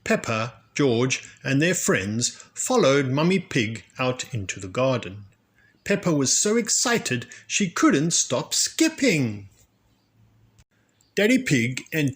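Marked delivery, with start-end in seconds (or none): none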